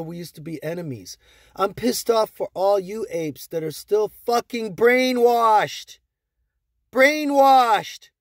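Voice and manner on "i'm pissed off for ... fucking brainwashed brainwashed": slow, rising into a loud repeated brainwashed; real anger. Real, not acted. Railing at brainwashed holders, angry shout. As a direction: spit out brainwashed in disgust. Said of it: "I'm fucking pissed off for her".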